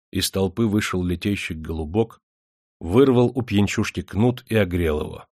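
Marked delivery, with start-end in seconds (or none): none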